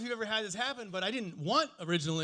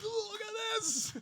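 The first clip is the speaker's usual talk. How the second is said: laughing taunt; 12 semitones higher, 9 dB quieter than usual. Pretend. Drunk taunting police from car.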